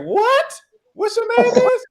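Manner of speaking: very high, incredulous squeal; baffled. Acted. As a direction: balk at the name in comic disbelief. Acted bafflement at her name.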